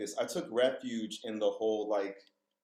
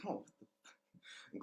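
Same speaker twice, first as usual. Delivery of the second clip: breathless stunned whisper, slowed; 3 semitones lower, 15 dB quieter than usual. Retold or imitated. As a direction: whisper it in shock. Stunned reaction to mystic remark.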